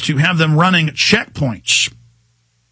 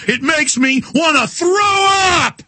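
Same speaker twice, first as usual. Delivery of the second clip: disgusted shout; 11 semitones higher than usual. Real, not acted. Raging radio rant.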